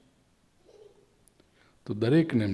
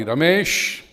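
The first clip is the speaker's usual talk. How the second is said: a loud, raised call across the house; calling out loudly; 6 semitones higher, 6 dB louder, much slower than usual. Acted. Father calls his eldest son from another room, loud call. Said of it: "એમ કહી મોટા દીકરાને બૂમ પાડી".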